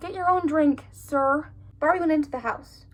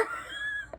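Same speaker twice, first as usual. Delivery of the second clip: sudden high unvoiced shriek of fright; startled scream; 13 dB quieter than usual. Real, not acted. Jump-scare picture appears, startled shriek.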